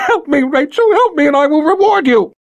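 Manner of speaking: high frantic plea with a laugh; desperate begging. Acted. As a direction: beg with a wild edge. Violet begging, laughing nervously.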